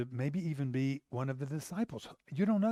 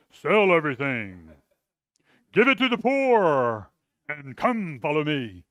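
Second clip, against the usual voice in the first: louder, stern orders; harsh barked command; 7 dB louder than usual. Shown as a demonstration. Preacher shows the wrong way Jesus spoke, as a harsh loud command. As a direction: bark it like a drill sergeant. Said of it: "I don't think Jesus goes".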